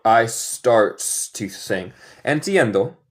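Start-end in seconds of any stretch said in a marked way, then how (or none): 0.0-1.9 "I starts to sing." spoken very slowly; slow test sentence; slow quiz read. Shown as a demonstration. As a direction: spell out "I starts to sing." word by word. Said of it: "es correcto o incorrecto".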